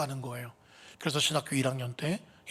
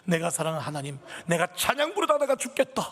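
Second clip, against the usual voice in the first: rising high and loud, passionate; fervent cry; 3 semitones higher, 4 dB louder than usual. Retold or imitated. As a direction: cry it out to God with all your heart. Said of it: "찬양하다가 설령 심장마비 오거나". (높고 크게 열정적으로 외치듯)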